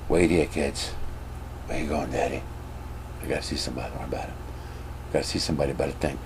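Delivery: low, very soft exchange; acted under-the-breath family dialogue; hushed secretive acting. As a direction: keep it furtive, like slipping off on shady business. Acts secretive dad leaving kids to buy.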